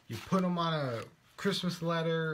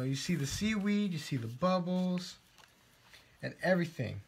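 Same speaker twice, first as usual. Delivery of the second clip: ordinary talk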